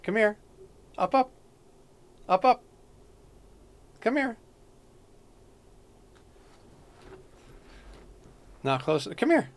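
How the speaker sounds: high, soft sing-song cat call; high coaxing call. Real, not acted. Streamer calls his cat up onto his shoulder, high coaxing. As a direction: coax the kitty up in a high little voice.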